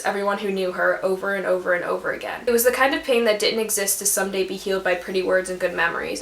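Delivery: soft, hushed reading of a heartfelt passage; lightly moved book reading; reading a quote aloud. Acted. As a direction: read it gently, like a quiet confession.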